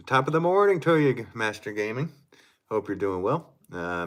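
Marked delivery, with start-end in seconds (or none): 0.1-2.1 loud, high Irish greeting; hammed-up Irish brogue; Irish accent. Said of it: "said that in Irish accent".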